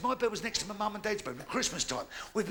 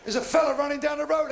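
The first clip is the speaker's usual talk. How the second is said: normal talking voice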